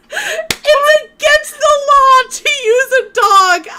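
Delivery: helpless high, loud laughing read; laughing through the words. Real, not acted. Streamer reads out a weird dog sled law, laughing. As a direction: squeal it out slowly through laughter.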